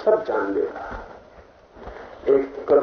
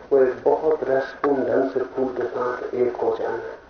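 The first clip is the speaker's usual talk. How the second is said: usual speaking voice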